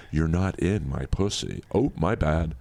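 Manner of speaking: ordinary talk, nothing marked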